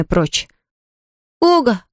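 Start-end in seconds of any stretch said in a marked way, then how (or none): 1.4-1.8 put-on high-pitched boyish call; surprised cry. A narrator's reading. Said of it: "крикнул Том". (звонкий удивлённый крик)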